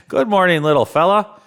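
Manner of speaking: a hint of a merry Santa waking the bear; jolly Santa. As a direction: greet him like a delighted Santa. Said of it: "asked Santa".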